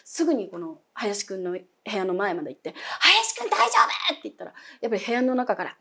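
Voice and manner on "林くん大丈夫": high, loud worried call; calling through a door. Retold or imitated. Checking on screaming roommate through door, high worried call. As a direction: shout through the door to a friend in trouble.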